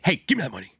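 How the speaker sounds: robber voice. Pretend. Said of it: "this robber voice".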